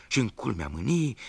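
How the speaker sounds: ordinary delivery, nothing marked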